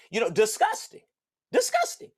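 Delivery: high disgusted exclamation; squealed disgust. Real, not acted. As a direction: recoil at the words. Reacting to the foul text.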